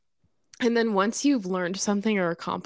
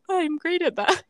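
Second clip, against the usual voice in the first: mock boast; 10 semitones higher than usual. Quoting herself boasting.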